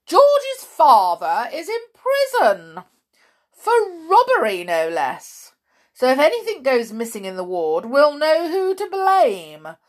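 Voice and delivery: high, loud, mocking announcement with fake laugh; gloating matron. A narrator's reading. Matron exposes George's father, gloating. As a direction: taunt the boy in front of everyone.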